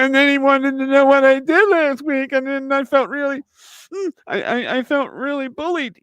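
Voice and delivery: high, far whiny complaining voice; mock the worker as a crybaby; whiny federal worker. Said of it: "you imagine they".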